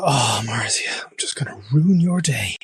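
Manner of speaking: whispered mock villainous musing; hushed, hammed-up scheming whisper. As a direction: whisper it like a pretend plotter. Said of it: "I wasn't gonna kinda go".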